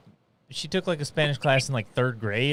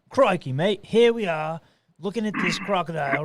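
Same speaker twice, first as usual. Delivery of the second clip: higher, croc-hunter Australian accent; 4 semitones higher than usual. Shown as a demonstration. Showing a warm-up phrase, Australian accent. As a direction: launch into a broad Australian accent. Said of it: "for Australian, it's".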